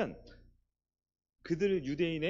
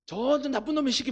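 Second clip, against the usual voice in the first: mock angry fan; 7 semitones higher than usual. Acts fan cursing opposing player.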